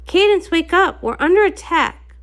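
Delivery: high, loud urgent wake-up call; alarmed cry to a sleeping princess. Acted. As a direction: shout her awake, danger is here. Waking her during an attack.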